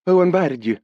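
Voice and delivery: put-on high, sharp young woman's retort; girl snapping at an intruder. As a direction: snap back at the stranger. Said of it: "she retorted".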